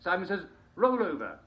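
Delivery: barking game commands up high; high game host command. Acted. Host calls Simon says moves to players, high and bright. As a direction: call the move to the players.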